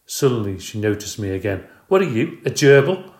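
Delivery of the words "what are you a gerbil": higher, much louder, scornful; wife sneering at the hamster; loud scornful question. Acted. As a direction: sneer at the creature.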